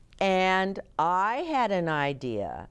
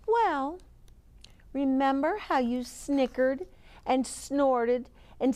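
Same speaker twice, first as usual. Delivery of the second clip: put-on high girl character voice; reproving Ruby; 8 semitones higher than usual. A narrator's reading. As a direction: remind the boys, high and pointed. Ruby answers the boys in story.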